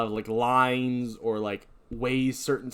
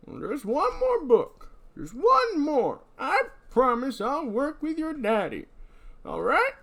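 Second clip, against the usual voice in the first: put-on high, slow, quavering old-timer; old prospector; 3 dB louder, slower than usual. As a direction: whine it like a rattled old codger. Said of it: "It just really works for this voice".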